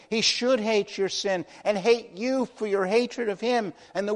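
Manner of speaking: high, loud hellfire sermon voice; fiery preaching. Acted. Preacher warning sinners of God's wrath, fiery and loud. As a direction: thunder the judgment at the congregation. Preaching judgment at full force.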